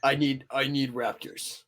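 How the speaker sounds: a slow, deep mock-villain growl; villain parody. Friend mocks the villain's obsession, slow and deep. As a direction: ham it up like a cartoon bad guy.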